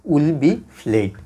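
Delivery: very slow, each word apart: will be played; slow dictation. Shown as a demonstration. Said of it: "rule অনুসারে আপনারা লিখে ফেলেন".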